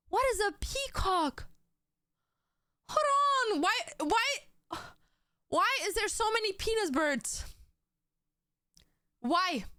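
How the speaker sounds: high, shocked disbelief at the birds; high-pitched shocked rant; shocked squeal. Real, not acted. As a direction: squeal it out in real alarm. Shocked at peacock on screen.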